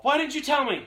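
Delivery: dad shouting. Retold or imitated. Father shouting at son who hid lost shoes, high loud outrage. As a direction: yell it like a father who can't believe it. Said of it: "my dad's like".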